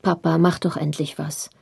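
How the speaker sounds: ordinary delivery, nothing marked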